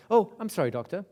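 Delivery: high, loud "oh", then settling; mock sudden composure. Client apologising to her therapist, sudden mock composure. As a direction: play a client abruptly snapping out of tears. Sobbing client abruptly pulls herself together.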